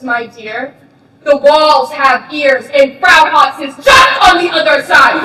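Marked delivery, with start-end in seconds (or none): 1.2-5.2 loud, slow warning that the walls have ears. Acted. To the cellmates, a loud, slow warning. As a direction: call it out so she hears. Warning of eavesdropping Frau Hopps.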